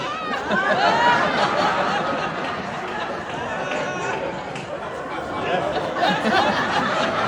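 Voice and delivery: long high loud laughing fit; big laugh. Real, not acted. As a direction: crack up hard at the setup. Laughing at brother's predicament.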